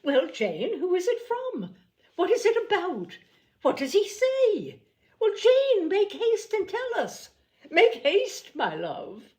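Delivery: high, loud, urgent questions; mother pressing Jane to read the note, eager and loud; eager calling out. Acted. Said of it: "eagerly calling out".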